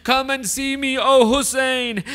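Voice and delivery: dying Habib calling Hussein, anguished cry. A narrator's reading. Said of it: "He cries out".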